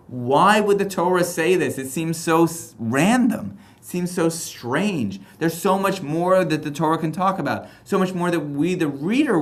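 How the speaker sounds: high, incredulous reader's complaint; high-pitched, stretched-out bafflement; baffled questioning. Acted.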